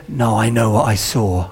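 low, quiet retort, retold; curt refusal. Offended man rejects apology.

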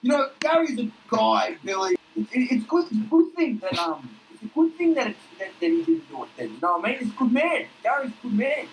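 high, gleeful crude bloke; Duck's voice. Acted. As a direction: pitch it up and gloat. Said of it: "he's like".

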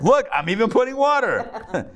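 high, boastful showman voice; Elijah the showman. Retold or imitated. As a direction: show off like a magician at a crowd. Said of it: "He's like".

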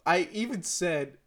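ordinary talk

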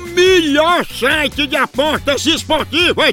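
upbeat radio ad voice; ad read. Acted. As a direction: sell it like a radio spot.

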